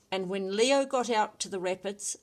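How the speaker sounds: plain reading voice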